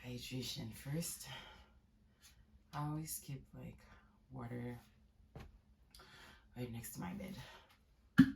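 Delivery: sleepy, hushed voice; soft morning voice. Real, not acted. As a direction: talk softly, just woken up. Soft morning talk after migraine.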